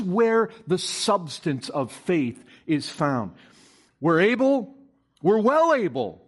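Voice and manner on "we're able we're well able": raised, louder eager claim; confident boast. Acted. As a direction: proclaim it like a bold spy sure of victory. Spies' confident claim acted out.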